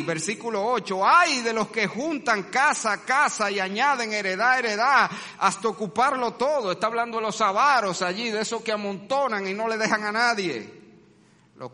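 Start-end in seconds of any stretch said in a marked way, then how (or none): 1.0-6.7 preaching the woes. Acted. Preaching the woes at full force.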